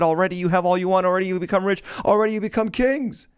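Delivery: high-pitched mock congratulation; sarcastic scripture quote, voice held high. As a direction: read it with pointed sarcasm, pitched well up. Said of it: "That's all sarcastic language right there".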